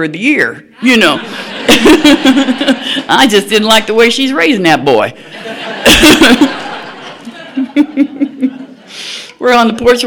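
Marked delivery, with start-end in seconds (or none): 1.2-3.0 high laugh. Real, not acted. Laughing at her own joke. 5.3-8.6 high laugh. Real, not acted. Laughing at her own joke.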